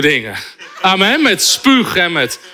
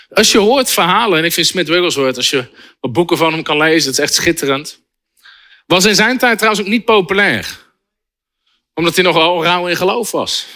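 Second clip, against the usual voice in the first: ordinary delivery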